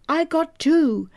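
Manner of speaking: high-pitched, contained yell; yelling back. A narrator's reading. Warrior answering the medicine man after battle, yelling back. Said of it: "Dances with Wolves yelled back".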